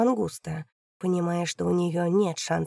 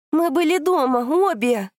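loud insistence; 9 semitones higher, 7 dB louder than usual. Acted. Daughter shouts alibi.

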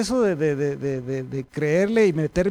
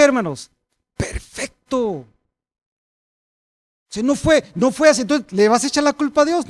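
loud, high-pitched sermon delivery; impassioned preacher at full volume; full-force preaching; 7 semitones higher, 8 dB louder than usual. Real, not acted. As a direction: challenge the congregation with loud, raised questions and answers.

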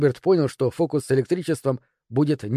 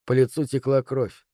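plain reading voice

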